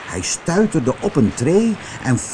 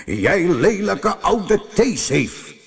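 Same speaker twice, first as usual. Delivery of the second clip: slow, higher-pitched storybook anger; angry call; 5 semitones higher than usual. A narrator's reading. Roodkop insulting the aunt on the stairs, slow angry call. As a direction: draw the insult out in acted anger. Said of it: "riep Roodkop boos".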